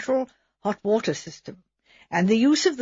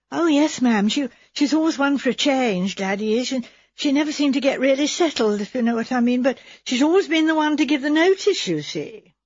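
higher, hurried protesting maid; flustered maid; 4 semitones higher than usual. Acted. Maid Edna assuring Miss Marple her cousin is innocent, higher and flustered. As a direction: rush to defend her cousin. Edna explains Gladys's habits.

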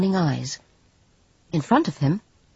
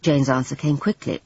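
ordinary delivery

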